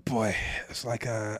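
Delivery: breathy whisper. Acted. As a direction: whisper it hoarsely.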